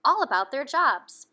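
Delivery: put-on reading aloud to children; lively bedtime-story narration; storybook reading. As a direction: tell the story to little ones. Reading a picture book aloud.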